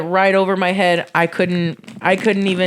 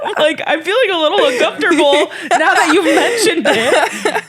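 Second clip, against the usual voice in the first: much higher laughing teasing question; 10 semitones higher, 3 dB louder than usual. Real, not acted. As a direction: tease him while giggling. Joking while laughing.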